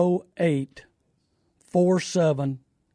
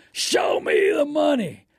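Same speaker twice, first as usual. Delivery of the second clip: a hammed-up catchphrase shout, drawn out; mock movie shout; 7 semitones higher, slower than usual. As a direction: show me the money, yelled high and slow.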